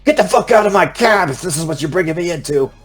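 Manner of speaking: high-pitched, irate outburst; angry cab driver. Acted. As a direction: throw the passengers out in a fury. Cab driver acted, ordering passengers out.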